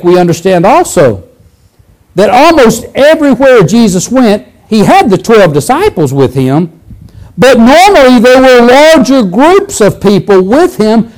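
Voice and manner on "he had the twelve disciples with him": normal talking voice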